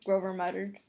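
ordinary delivery, nothing marked

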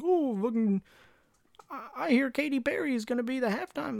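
mock high airhead voice; bored American fan. Mocking Super Bowl fans in a high, silly voice. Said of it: "people are like".

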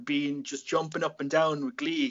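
ordinary delivery

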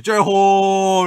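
very loud, high, long-held shout; 진영 bursting in. Retold or imitated. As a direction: holler it as a grand entrance, vowel stretched. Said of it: "이러면서 들어오더라고". (형 흉내 내며 크고 길게 외치기)

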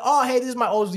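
high, loud mock-flustered greeting; flustered introduction. Ex introducing him to her husband, imitated fluster. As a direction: imitate a caught-out, high-pitched hello.

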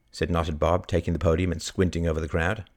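usual speaking voice

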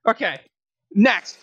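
ordinary talk

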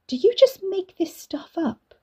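put-on high, doubtful girl's voice; girl doubting her cousin's omen, high and sceptical; incredulous. A narrator's reading. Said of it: "Katie asked".

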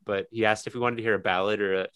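usual speaking voice

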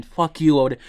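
a loud, spat-out curse at Odin; angry curse. Real, not acted. Gamer cursing the god who trapped Freya, loud and angry. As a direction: swear at him like you mean it.

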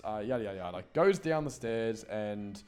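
ordinary delivery

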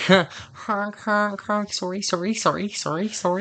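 high honks, then sorry sorry; apologetic honking geese. Pretend. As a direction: honk like a sorry Canadian goose. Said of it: "Do you think they're saying sorry".

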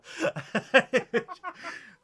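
high burst of laughter; laughing. Real, not acted. Host laughing at the Tommy Cooper likeness. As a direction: crack up helplessly.

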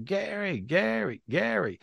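high calling cry of Gary, Gary, Gary; retold spirit screaming name. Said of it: "he was screaming my name".

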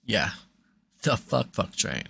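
usual speaking voice

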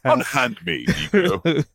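hammed-up English stage voice; overdone period-drama English actor; hammy stage actor. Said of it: "talking with an English accent".

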